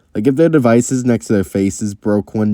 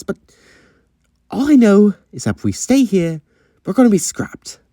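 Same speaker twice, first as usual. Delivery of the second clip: higher-pitched, stammering acted plea; flustered character, pleading to get away; worried urgency; 8 semitones higher than usual. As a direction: scramble anxiously for a plan. Sean pleads to escape being scrapped.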